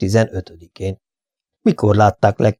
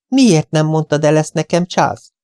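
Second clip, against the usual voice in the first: higher-pitched angry reproach; haragos; 6 semitones higher than usual. A narrator's reading. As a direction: demand an answer, pitched up. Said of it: "kérdezte haragosan Tereza". (haragos számonkérés, magasabb hangon)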